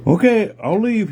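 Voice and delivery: acted high-pitched okay; bear giving in. Big bear backs off.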